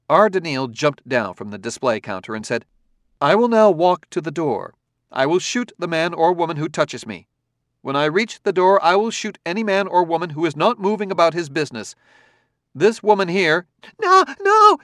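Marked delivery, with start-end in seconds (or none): 3.2-13.7 confident command. A narrator's reading. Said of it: "confident command". 14.0-14.8 high loud panicked cry; frightened yell. A narrator's reading. Frightened woman yelling at the robot. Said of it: "yelled the woman with the hat".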